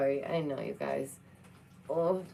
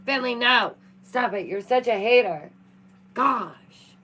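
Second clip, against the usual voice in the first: loud, higher shout at the cat; loud exasperated yell at the pet; 6 semitones higher, 9 dB louder than usual. Real, not acted. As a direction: shout him down, half laughing. Yelling at cat.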